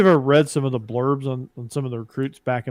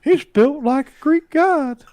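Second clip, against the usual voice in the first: put-on high, slow leering old man voice; Herbert the Pervert voice; 13 semitones higher, 6 dB louder, slower than usual. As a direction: drawl it like a creepy old man. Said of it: "in the voice of Herbert the Pervert".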